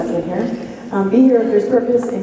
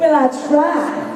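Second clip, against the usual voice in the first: higher, drawn-out Southern accent; put-on Southern lilt, stretched out; 6 semitones higher than usual. Shown as a demonstration. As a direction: try out a slow Southern accent. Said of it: "give us a good Southern accent".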